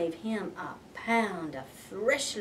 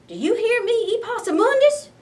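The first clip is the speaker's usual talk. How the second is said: much higher, loud call of a stern mother; mama calling out; 11 semitones higher, 8 dB louder than usual. Acted. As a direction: call him sharply. Mama calling her son.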